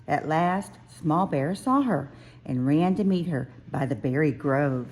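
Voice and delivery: ordinary delivery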